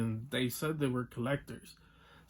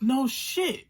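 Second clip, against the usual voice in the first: sarcastic retort; 10 semitones higher, 5 dB louder than usual. Retold or imitated. Retold sarcastic reply to clerk.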